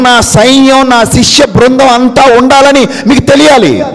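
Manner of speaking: high, loud preacher's proclamation; full-force preaching. Acted. Preacher telling the congregation their true place, loud and high. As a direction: proclaim it to the whole hall.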